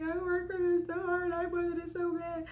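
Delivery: very high re-enacted tearful voice; emotional, overcome. New nurse to her sister, overcome high voice. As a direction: pour it out high, as if crying. Tearful reply after passing, retold.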